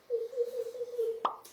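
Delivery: put-on high, loud childish cackle; high mocking kid laugh. Little boy laughing at Camille after hitting her with a ball, high and mocking.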